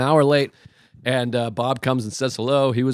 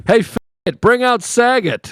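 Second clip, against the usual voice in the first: mock heckle, higher and louder; heckler yell; 8 semitones higher, 4 dB louder than usual. Heckler yelling at the opener, mock yell. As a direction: shout it from the back like a heckler. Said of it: "a guy yelled out".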